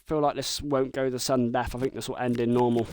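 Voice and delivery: normal talking voice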